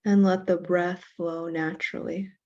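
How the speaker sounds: slow, measured meditation voice with long pauses; guided meditation. Real, not acted. Teacher leading a class in self-compassion breathing, guided meditation. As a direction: count the breath out evenly, unhurried, guiding them into stillness.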